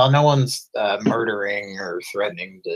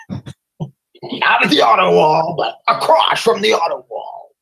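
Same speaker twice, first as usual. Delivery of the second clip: high, slow, loud ad pitchman; car dealer voice; 9 semitones higher, 7 dB louder than usual. Retold or imitated. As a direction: shout it like a cheesy car ad. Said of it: "he sounded like this".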